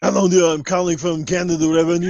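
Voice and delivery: lightly voiced scam caller, a touch of a put-on accent; hint of a fake tax-office caller; spoof scam caller impression. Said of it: "I'll do the Ernie, the impression".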